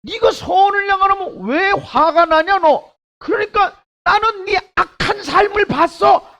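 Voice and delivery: high, loud rebuking voice; God rebuking Cain. Acted. Pastor voices God scolding angry Cain, loud and high. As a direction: thunder God's rebuke down on him. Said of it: "하나님이 뭐라 그럽니까?".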